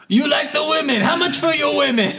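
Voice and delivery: high laughing mock shout. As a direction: yell it through laughter, mocking the rival. Mocking rival, laughing.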